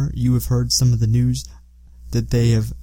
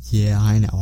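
ordinary talk

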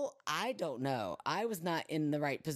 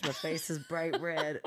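usual speaking voice

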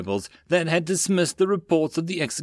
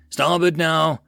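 higher, louder urgent yell; yelled order; 5 semitones higher, 5 dB louder, slower than usual. A narrator's reading. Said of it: "yelled the X O".

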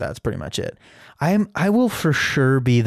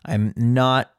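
ordinary delivery

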